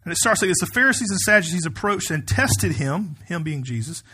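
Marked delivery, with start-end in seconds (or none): 0.6-3.2 raised, emphatic pulpit reading voice; scripture reading. Acted. Pastor reading the Gospel to his congregation, emphatic scripture reading. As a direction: read it out firmly to a congregation.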